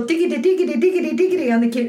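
quick, high-pitched patter; imitated keyboard tinkling. Keyboard still playing on, imitated with quick patter. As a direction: rattle it off like a little piano riff.

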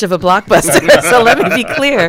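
a real amused laugh breaking into the line. Real, not acted. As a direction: laugh through the words while playing down the hype. Downplaying her own cliffhanger, laughing.

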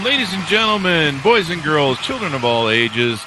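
a hint of a circus barker; lightly voiced showman calling the crowd; ringmaster.

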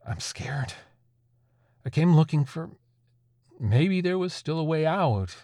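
fearful and halting, losing the thread; scared confession. Acted. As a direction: plead faintly for a way out. Satanist admits he is scared.

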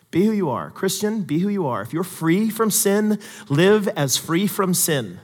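usual speaking voice

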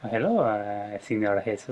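a lightly voiced seductive Spanish greeting; flirty Spanish accent. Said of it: "Yeah, see?".

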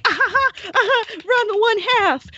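hammed-up high fan cackle; dumb fan laugh. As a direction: play a clueless fan who laughs and names the show. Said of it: "going to be like".

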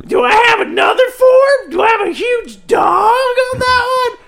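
high, loud, wailing questions; shrill cartoon voice. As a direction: whine it like a cartoon creature. Balloon asking about evolution.

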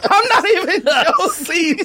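laughing through the words, voice jumping high; can't stop laughing while insisting he's not joking. Real, not acted. Laughing at his own story.

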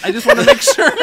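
very high, loud laughing; laughing hard. Real, not acted. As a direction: squeal it through laughter.

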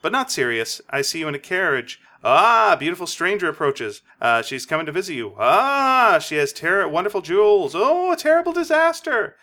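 mystical rising fortune-teller voice; spooky carnival seer, building drama. Retold or imitated. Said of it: "she's trying to tell the fortune".

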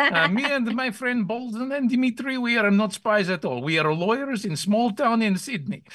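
bad Russian-Kazakh spy accent; mock Eastern European accent. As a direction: deny being a spy in a thick comic accent. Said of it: "you're a spy from Kazakhstan".

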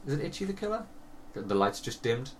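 ordinary talk

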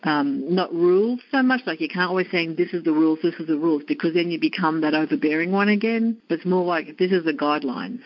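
ordinary talk, nothing marked

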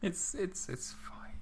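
whisper. Real, not acted. Co-host reassuring quietly.

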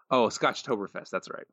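ordinary delivery

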